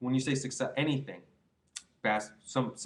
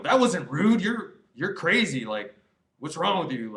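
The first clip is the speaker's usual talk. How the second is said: mock defensive retort; 4 semitones higher, 5 dB louder than usual. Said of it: "I'm not gonna".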